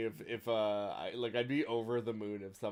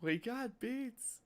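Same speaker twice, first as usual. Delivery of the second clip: much higher, slow mock meme; soft squeaky quote; 10 semitones higher, 5 dB quieter, much slower than usual. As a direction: squeak it softly. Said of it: "quoting the meme".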